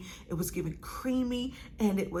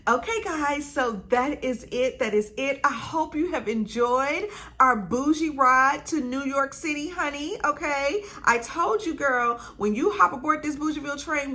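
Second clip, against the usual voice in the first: higher, animated outro energy; upbeat sign-off; 4 semitones higher, 4 dB louder than usual. Real, not acted. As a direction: wrap up brightly with sass for the viewers.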